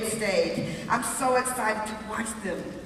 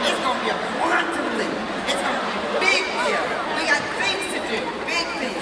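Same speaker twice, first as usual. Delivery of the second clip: higher, forceful, sassy rallying cry; with attitude; 6 semitones higher, 5 dB louder than usual. Shown as a demonstration. Speaker shows the crowd how to promise big things, with attitude. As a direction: hit it hard with swagger. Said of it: "say it with some attitude".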